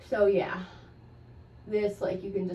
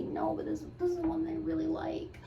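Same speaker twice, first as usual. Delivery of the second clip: higher, sweet pretend insistence; imagined polite refusal; 9 semitones higher than usual. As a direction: play her future self gently refusing. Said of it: "I'm gonna say".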